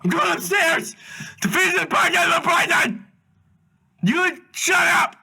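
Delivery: high, loud scream at someone downstairs; furious yelling. Pretend. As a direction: yell it at full volume, fed up. Acted yelling at the captive.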